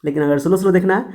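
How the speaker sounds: ordinary talk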